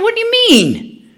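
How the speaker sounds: very high, disbelieving squeak; incredulous. Retold or imitated. Grandmother retorting to her daughter, very high and disbelieving. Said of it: "I said".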